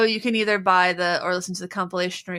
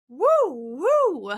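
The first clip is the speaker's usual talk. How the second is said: cheering; 8 semitones higher than usual. Acted. Cheering the podcast sponsor.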